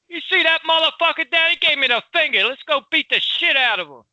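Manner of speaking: high-pitched, obnoxious Philly street talk; loud, obnoxious Philly. Acted. Showing friend the Philly sound, loud and obnoxious. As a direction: play an obnoxious Philly local spoiling for a fight. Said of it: "it's loud, obnoxious".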